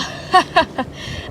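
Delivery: breathy evil snicker; evil laugh. Acted. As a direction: snicker at her. Villain laughs.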